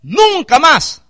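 high, loud, slow declaration; Moses proclaiming. Retold or imitated. Preacher quoting Moses on the Egyptians, loud slow proclamation. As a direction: stretch each word out forcefully. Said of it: "les dijo".

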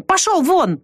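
sharp high yell at a dog; shout. A narrator's reading. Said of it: "крикнула она".

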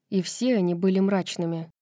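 ordinary delivery, nothing marked

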